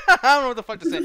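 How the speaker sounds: laughing it out, fast and loud. Real, not acted. To the group after quitting, laughing loudly. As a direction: blurt it through a burst of laughter.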